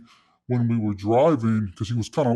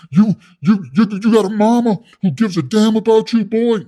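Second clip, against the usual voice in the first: loud, much higher, stammering into it; forceful demand; 9 semitones higher, 6 dB louder than usual. Retold or imitated. Inmate grilling the rookie about his mama, forceful. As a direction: confront him as the fired-up inmate. Acting out cellmate confronting him.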